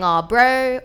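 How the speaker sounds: cheeky New Zealand bro accent; Kiwi street accent. Shown as a demonstration. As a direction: greet like a Kiwi street kid. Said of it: "So they'll be saying".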